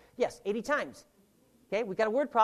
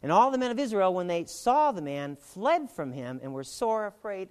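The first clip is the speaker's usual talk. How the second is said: raised, declaiming Bible reading voice; scripture reading; 7 dB louder than usual. Acted. Teacher reading Jesse's words to the class, declaiming. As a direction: proclaim the verse to the room.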